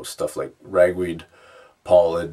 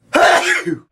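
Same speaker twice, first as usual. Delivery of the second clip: sharp explosive sneeze; loud sudden sneeze; violent sneeze; 10 dB louder than usual. Real, not acted. Sneezing test with eyes open.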